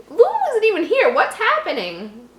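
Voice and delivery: baffled. Real, not acted. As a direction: protest the plot hole. Confused at story.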